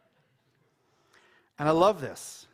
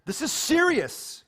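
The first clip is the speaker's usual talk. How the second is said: high, slow, weighty stress on serious; grave emphasis; 11 semitones higher than usual. Real, not acted. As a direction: lean hard into serious, slowly. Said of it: "I know I'm making light of it".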